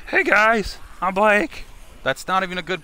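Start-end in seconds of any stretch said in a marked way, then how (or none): 0.1-1.6 higher, louder bad Blake voice; hammed-up Blake greeting; Blake impression. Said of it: "Do a Blake impression".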